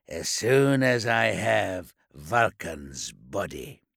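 slow, low, gloating voice; sly menacing warlord. Acted. Lesteigen's sly reply.

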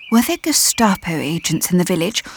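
ordinary delivery, nothing marked

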